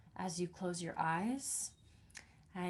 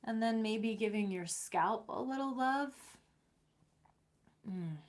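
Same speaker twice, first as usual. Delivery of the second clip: guided meditation. Acted. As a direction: ease them gently through each step. Guides a self-massage.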